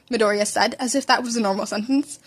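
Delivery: ordinary delivery, nothing marked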